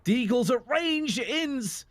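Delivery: high, excited esports commentary; play-by-play. Acted. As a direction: build the hype through the kills.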